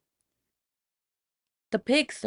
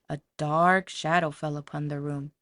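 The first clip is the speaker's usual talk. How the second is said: ordinary delivery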